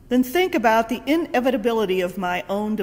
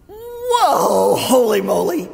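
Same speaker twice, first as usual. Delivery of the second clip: high, loud startled cry; shocked whoa; 3 semitones higher, 7 dB louder, slower than usual. Retold or imitated. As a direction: recoil from the sight. Shocked at rotting body.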